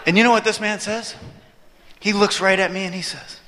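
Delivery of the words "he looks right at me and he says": usual speaking voice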